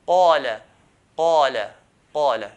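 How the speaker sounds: "qala" loud, with the thickest, fullest "qa"; heaviest qaf. Shown as a demonstration. Teacher giving the fatha example, heaviest "qala". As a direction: hit "qala" with the strongest heavy qaf. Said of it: "will be the heaviest".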